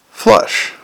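breathy whispered "Flush.". A narrator's reading. Narrator hissing the flush sound. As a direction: hiss it like a rush of water.

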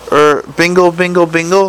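small, hesitant imp voice; a timid chime, unsure of itself; nervous little imp. A narrator's reading. The organiser imp nervously chimes for Vimes, timid. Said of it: "said a small and very nervous voice".

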